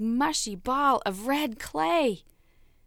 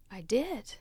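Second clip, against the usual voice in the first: ordinary delivery, nothing marked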